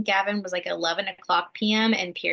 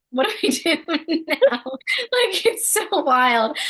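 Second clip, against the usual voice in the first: laughing bewilderment; 10 semitones higher, 5 dB louder, slower than usual. Retold or imitated. New parents bewildered at home.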